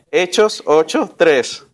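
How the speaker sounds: "Hechos ocho tres" said again slowly, each word spaced out; slow it down so the class can find Hechos ocho tres. Shown as a demonstration. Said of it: "Va de nuevo".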